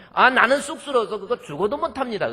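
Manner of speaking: shy Gyeongsang man refusing, laughing; bashful Gyeongsang refusal. Retold or imitated. Gyeongsang pastor too shy to say it to his wife, bashful refusal in Gyeongsang accent. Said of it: "경상도 사람인데".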